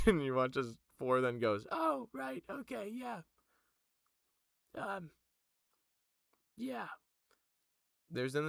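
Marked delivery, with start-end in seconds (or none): 1.7-6.9 put-on much higher, curious young voice; Four's voice. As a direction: ask it up high and innocent. GM voices Four.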